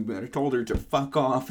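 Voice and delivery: plain reading voice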